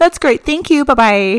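high, loud, fake-cheerful sign-off; chirpy call-center goodbye; customer service voice. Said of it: "I'm like".

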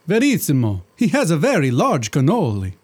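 put-on thick comic Italian accent; hammed-up Italian genie. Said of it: "poor attempt at an Italian accent".

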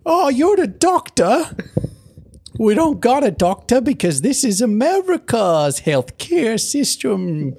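high, slow bumpkin voice; high character voice. Pretend. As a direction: play a squeaky frontier yokel.

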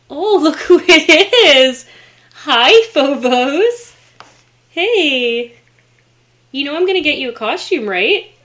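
high, loud delighted squeal at a pet. Real, not acted. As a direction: gush over the cat with a laugh. Excited greeting of cat.

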